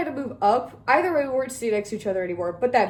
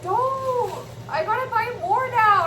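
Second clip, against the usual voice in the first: much higher whiny mom; imitating mom complaining; mock mom; 11 semitones higher, slower than usual. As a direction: squeak it like a nagging mom. Mom's complaint about bags.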